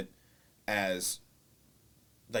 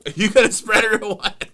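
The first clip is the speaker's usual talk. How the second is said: louder and higher, a mock impatient call; hammed-up nudge at the person cooking; loud teasing quote; 12 semitones higher, 14 dB louder than usual. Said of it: "sitting here with the phone going".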